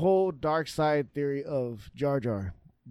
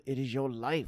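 Obi-Wan lecturing; 5 semitones lower, 7 dB quieter than usual. Retold or imitated. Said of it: "when he was lecturing Anakin".

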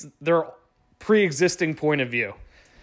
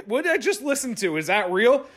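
laughing disbelief; 9 semitones higher than usual. Retold or imitated. The narrator reading the father-in-law's email, laughing in disbelief. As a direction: throw up your hands, cracking up.

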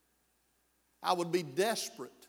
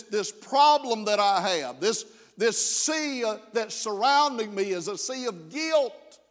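full-force preaching; 3 semitones higher, 4 dB louder than usual. Acted. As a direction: press the weight of sin on the congregation.

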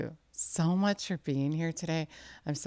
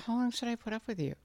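higher, faster exasperated complaint; fed up; 7 semitones higher, faster than usual. Acted. Jesus up to his neck in their lack of faith, exasperated. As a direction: act out patience running out. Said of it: "That's how it sounded, right?".

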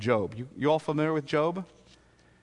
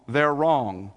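ordinary delivery

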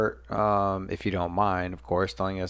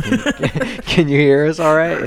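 louder, laughing through it; louder repeat; 7 semitones higher, 9 dB louder, slower than usual. Real, not acted. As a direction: repeat it louder so they hear. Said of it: "I said".

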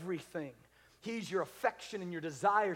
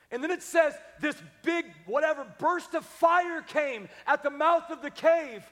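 dramatic preaching; 8 semitones higher, 8 dB louder than usual. Real, not acted. Preacher telling Elijah's burst of fire, dramatic and loud. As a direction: make the blast big and loud.

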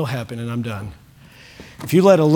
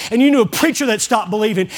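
ordinary delivery

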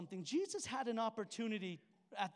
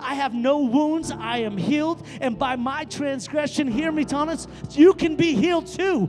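louder and louder, booming, slowing to hammer it home; fiery preaching; 6 semitones higher, 16 dB louder than usual. Acted. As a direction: preach it at full force. Preaching at full force.

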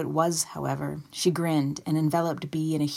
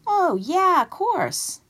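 very high, loud exclamation; cried out; 13 semitones higher, 7 dB louder, slower than usual. A narrator's reading. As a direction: cry out as if just remembering. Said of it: "and then cried".

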